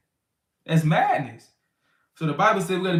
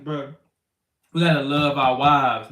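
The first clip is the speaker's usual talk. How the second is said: ordinary talk, nothing marked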